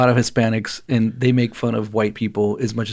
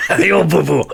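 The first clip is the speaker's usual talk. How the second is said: put-on Japanese accent; 5 semitones higher, 4 dB louder than usual. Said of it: "in a Japanese accent".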